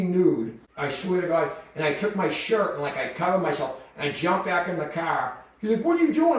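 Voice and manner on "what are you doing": high, louder mock disbelief; incredulous. Coworker to his half-naked partner, high imitated disbelief. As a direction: imitate a bewildered coworker, pitched way up.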